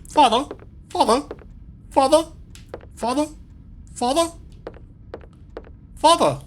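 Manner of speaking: put-on high, worried child voice calling out; calling for father. A lost son calls for his father in a high worried voice. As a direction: call again and again, high and anxious. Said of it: "Why am I talking like this?".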